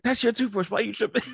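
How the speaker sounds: imitating a caught-out man, voice shooting up high; mock defensive protest. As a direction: squeak out a flustered denial, playing it up. Man retold caught out, deflecting.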